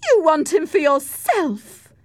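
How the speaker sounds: loud, high, drawn-out outburst; accusing cry. A narrator's reading. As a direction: fling the accusation at a rival. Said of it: "cried Isabella".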